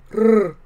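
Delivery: loud high rolled trill; loud trilled purr; cat purr. A narrator's reading. James being scratched under the chin, loud purr. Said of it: "James said".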